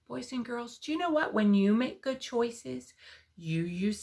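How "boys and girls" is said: ordinary delivery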